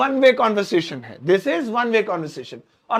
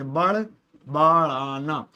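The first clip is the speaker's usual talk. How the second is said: slow spelled-out word; 5 semitones lower, much slower than usual. Shown as a demonstration. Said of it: "बड़बड़ाना भी कह लीजिए".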